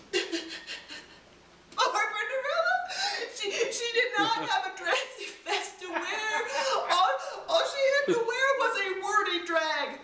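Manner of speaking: high, laughing fake sob; mock crying. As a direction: ham up a wail for poor Cinderella. Pitying Cinderella in the story.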